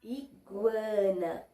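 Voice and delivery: "iguana" slowly, gw clearly heard; slow syllables. Shown as a demonstration. Teacher repeats for pupils, slow and clear. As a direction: stretch "iguana" so each syllable lands. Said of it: "Conseguimos ouvir o som".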